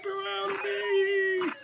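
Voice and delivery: mock high plea.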